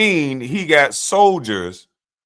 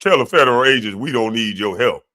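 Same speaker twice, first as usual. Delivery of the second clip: lower, put-on mocking voice of the governor; mocking quote of the governor; 5 semitones lower than usual. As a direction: parrot his words low and scornfully. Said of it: "wording it like this".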